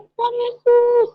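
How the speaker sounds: high, loud, drawn-out cry to God; retold scream. Wife cries out as motorbike hits container, high shout. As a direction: re-enact calling out in sudden alarm. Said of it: "Saya teriak".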